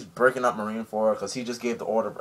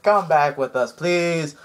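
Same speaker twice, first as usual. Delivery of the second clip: pleading Ace; 5 semitones higher, 5 dB louder, slower than usual. Retold or imitated.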